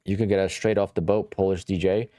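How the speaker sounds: normal talking voice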